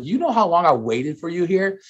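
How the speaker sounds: lightly voiced sister who has waited too long; impatient sister. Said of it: "She's like".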